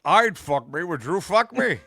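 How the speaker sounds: hammed-up Scottish accent; Scottish star miscast as a movie killer; Sean Connery. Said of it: "He's in Silence of the Lambs".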